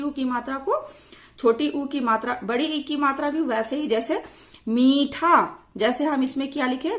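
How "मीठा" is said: मीठा slowly, with a long ee; drawn-out vowel. Shown as a demonstration. Teacher giving a long ee example, मीठा drawn out. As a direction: stretch मीठा out, holding the first vowel. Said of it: "थोड़े खींचकर लंबे समय के लिए".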